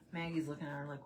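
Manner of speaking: normal talking voice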